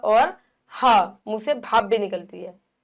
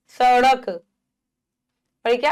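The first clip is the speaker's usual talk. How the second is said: usual speaking voice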